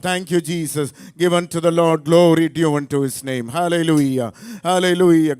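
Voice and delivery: rally the congregation into worship; fervent preaching. Real, not acted. Rouses congregation to praise.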